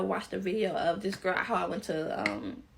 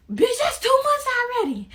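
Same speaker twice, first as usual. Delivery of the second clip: much higher and louder mock shriek; shocked outburst; 9 dB louder than usual. Realizing how far along she is, shocked shout. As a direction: exclaim it in retold disbelief. Shocked at her own pregnancy weeks.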